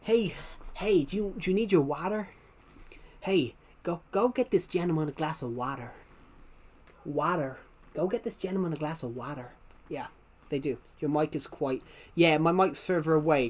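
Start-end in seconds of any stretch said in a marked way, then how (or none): 0.0-9.5 mock American waiter. Imitating Americans asking about water, lightly mocking. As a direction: lightly mimic an eager American server. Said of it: "Americans say water".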